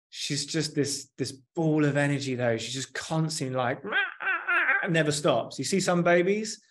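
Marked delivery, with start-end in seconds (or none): 3.8-4.9 put-on high, squealing baby noises with a laugh; baby babble imitation. Telling a friend about his daughter Rumi, high laughing baby babble. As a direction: mimic a baby who never stops.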